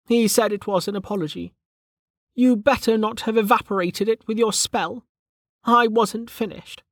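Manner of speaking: high-pitched irritable character voice; Velika, irritable. Acted.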